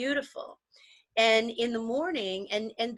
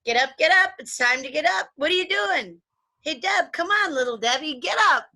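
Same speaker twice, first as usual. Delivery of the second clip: loud, high, teasing rouse; playful father booming at his sleepy daughter; energetic dad wake-up; 7 semitones higher, 5 dB louder than usual. Retold or imitated. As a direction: bounce in like a comedian dad. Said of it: "he had a whole nother style".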